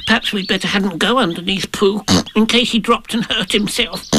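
high, anxious little creature; nervous squeaky character; Piglet's voice. Acted. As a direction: ask timidly in a small high voice. Piglet's figure voice.

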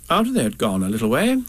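ordinary delivery, nothing marked

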